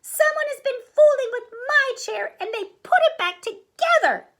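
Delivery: loud, very high little voice; stomping tantrum in a tiny squeal; screeching baby bear. A narrator's reading. Baby Bear stomps over the chair, screeching. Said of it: "Baby Bear screeched, stomping up and down".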